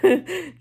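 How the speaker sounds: a burst of high, loud laughter. Real, not acted. Telling viewers about the payout, a high burst of laughter. Laughs at her own win.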